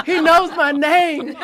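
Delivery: high, slow, laughing with delight; giddy laugh. Retold or imitated. Starstruck guest laughing that the president knows her name. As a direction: gush it through giggles, slow and high. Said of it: "And I was like".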